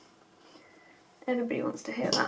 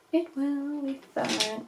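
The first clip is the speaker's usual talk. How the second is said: slow, stretched self-reassurance; drawn-out reassurance; 3 semitones higher, slower than usual. Real, not acted. Vlogger reassuring herself about the crease, drawn out. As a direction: draw out every word slowly.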